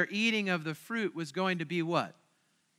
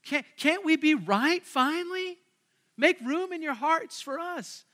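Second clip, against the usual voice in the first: higher, slow plea as Paul; mock pleading apostle voice, much higher; 8 semitones higher than usual. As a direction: beg them to make room for us. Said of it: "Paul's pleading here".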